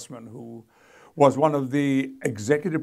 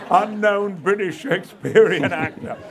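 mock grand announcement; 7 semitones higher, 8 dB louder than usual. Reading the comic warning sign.

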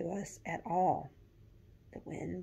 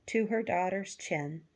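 ordinary delivery

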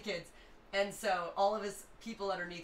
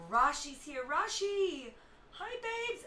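excited greeting; 11 semitones higher, 6 dB louder, much slower than usual. Real, not acted. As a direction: gasp then high squeal of welcome. Greets arriving friend excitedly.

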